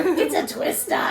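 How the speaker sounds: put-on high Brooklyn accent, laughing. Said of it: "In your Brooklyn accent".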